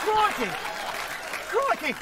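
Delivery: hammed-up Aussie crikey; a mock Australian crikey; Australian exclamation. Guards exclaiming crikey in mock Australian accent. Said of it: "Australian soap opera".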